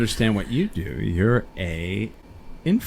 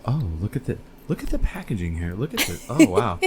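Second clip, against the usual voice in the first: softer, lightly voiced influencer showing off packaging; mock influencer; 3 dB quieter than usual. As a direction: imitate an influencer's hushed amazement. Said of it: "that thing where you're all".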